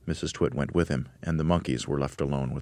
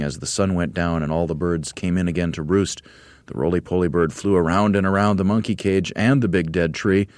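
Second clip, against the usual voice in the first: ordinary delivery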